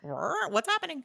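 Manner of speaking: high-pitched imitation of startled monsters; roar, then squawk it high in mock alarm; mock roaring horde. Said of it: "they're like".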